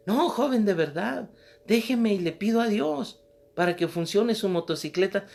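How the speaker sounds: put-on high, earnest little old lady; old woman pleading. Preacher voicing the old woman, high and pleading. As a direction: beg him to let her pray.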